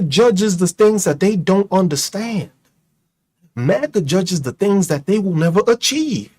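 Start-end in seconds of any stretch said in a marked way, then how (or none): none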